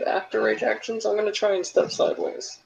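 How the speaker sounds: normal talking voice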